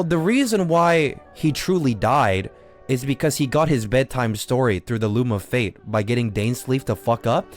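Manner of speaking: usual speaking voice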